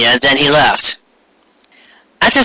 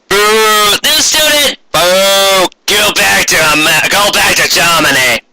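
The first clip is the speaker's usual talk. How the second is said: high mocking boos; jeering classmates; 5 semitones higher, slower than usual. A narrator's reading. Classmates booing the new student, high and jeering. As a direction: boo her like a rude schoolkid.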